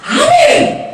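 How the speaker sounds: high, loud shouted "아멘!"; loud amen. Retold or imitated. Showing the congregation her eager loud amen in church. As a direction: belt out the amen like the keen newcomer. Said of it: "아멘을 크게 해야 된대요".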